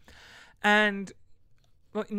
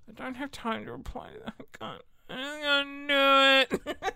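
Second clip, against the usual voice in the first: mock crying; 7 semitones higher, 6 dB louder than usual. Mock breakdown over emails, then "tears.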